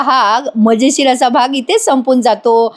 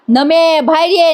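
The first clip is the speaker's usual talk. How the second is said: calling out; 5 semitones higher, slower than usual. Retold or imitated. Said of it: "गोंधळ घालते".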